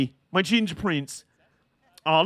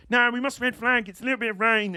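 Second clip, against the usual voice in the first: higher-pitched mock killjoy protest; 7 semitones higher than usual. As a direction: play the fussy killjoy, pitched up. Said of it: "the killjoy who's like".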